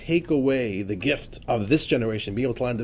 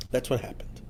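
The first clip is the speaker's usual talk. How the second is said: whisper; 3 semitones lower, 9 dB quieter than usual. Real, not acted. Hushed remark after a slow line.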